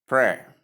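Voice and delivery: prayer as PRAIR with an R sound ending; prayer in one syllable, R sounded at the end; American pronunciation. Shown as a demonstration. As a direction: say prayer the American way, short E then R. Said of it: "In American pronunciation".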